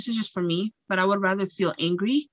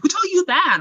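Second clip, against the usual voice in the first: skeptical mom; 11 semitones higher, 5 dB louder than usual. Retold or imitated. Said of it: "She's like".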